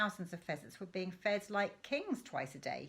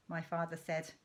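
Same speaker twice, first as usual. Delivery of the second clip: plain reading voice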